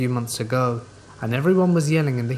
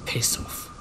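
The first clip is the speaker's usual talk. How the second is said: usual speaking voice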